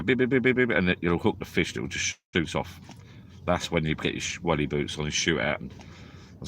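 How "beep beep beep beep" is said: high rapid beeps like a bite alarm; excited mock beeping; imitating alarm beeps. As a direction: mimic the alarm going off. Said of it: "then it'll go".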